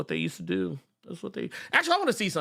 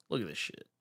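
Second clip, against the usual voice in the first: ordinary talk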